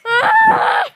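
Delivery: high sudden scream; panicked scream. Real, not acted. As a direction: scream in fright. Scared by tornado in game.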